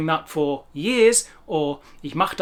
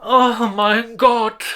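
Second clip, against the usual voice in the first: slow, drawn-out exasperated oh my God; exasperated groan; 2 semitones higher, 5 dB louder, much slower than usual. Real, not acted. Teacher despairing at Germans' mistakes, slow and exasperated.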